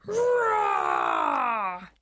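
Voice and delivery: deep booming dinosaur roar. Pretend. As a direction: roar low like a huge dinosaur. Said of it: "I'm making the sound of a dinosaur".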